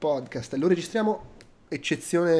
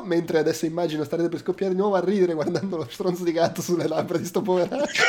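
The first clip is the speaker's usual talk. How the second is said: ordinary talk, nothing marked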